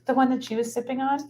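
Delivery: loud high-pitched teasing question; playful high voice. Real, not acted. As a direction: ask it like a giddy joke.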